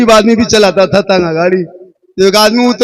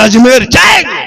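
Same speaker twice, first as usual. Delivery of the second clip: defiant shout; 6 semitones higher, 5 dB louder than usual. Acted. Husband defiantly answers his wife.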